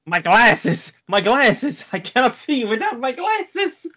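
rising, high-pitched character wail; theatrical blind distress, building in pitch; hammed-up panic. Character panics over broken glasses.

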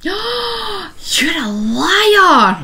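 dramatic gasp, then high, drawn-out accusation; scandalised, swooping mock cry; mock outrage. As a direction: accuse them in hammed-up shock. Teasing accusation after the knitting claim.

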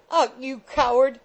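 high, loud wifely scorn; supreme contempt. A narrator's reading. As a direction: spit contempt at a timid husband. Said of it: "replied Missus Rattle with supreme contempt".

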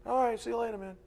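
high, breezy mock goodbye; casual parting. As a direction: say it like a throwaway farewell. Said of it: "We treat it like a parting".

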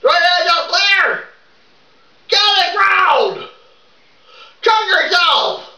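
full-voice boot camp shouting, rehearsed; rehearsed drill-sergeant yelling. Pretend. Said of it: "you don't have to yell it".

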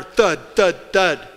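imitated thuds, each hit harder. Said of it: "Then louder".

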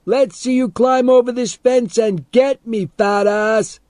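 high, loud jeering dare; taunting shout. A narrator's reading. Boy goads the man over the fence, shouting. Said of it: "Teddy shouted back".

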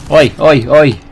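loud high oi oi oi; calling out. Real, not acted. Player yelling at an enemy, loud call. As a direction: shout to grab the enemy's attention.

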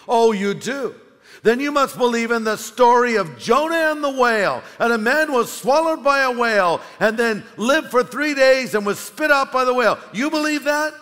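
loud, high, mocking challenge; scoffing atheist. Atheist taunting the girl about Jonah, loud and scoffing. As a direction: scoff at her faith with a booming voice.

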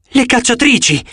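high-pitched exclamation; exclaiming. A narrator's reading. Annabeth spots the huntresses arriving, exclaiming. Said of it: "esclamò Annabeth".

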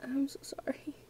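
breathy hushed apology; real hushed whisper of sorry. Real, not acted. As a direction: whisper so nobody hears. Whispering while hiding in game.

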